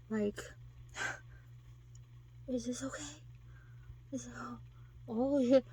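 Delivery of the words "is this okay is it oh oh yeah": breathy hushed question, no voice; mock whispered ask. Imitating a man awkwardly asking, whispered.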